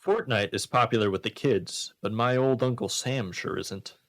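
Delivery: ordinary talk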